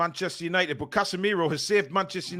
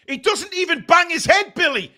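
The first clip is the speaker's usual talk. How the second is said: higher, louder protest at the screen; outraged yell at the cheating; indignant shout; 11 semitones higher, 5 dB louder than usual. Real, not acted. Fan telling Billy the injury is fake, indignant shout.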